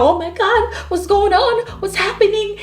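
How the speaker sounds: high, panicky mock alarm; imitating a frantic wake-up panic; freaking out. Violet waking with a ring, mock panicked. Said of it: "And she's freaking out".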